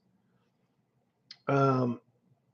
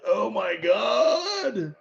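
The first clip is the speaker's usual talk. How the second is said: stunned; 4 semitones higher, much slower than usual. Retold or imitated. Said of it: "And I'm like".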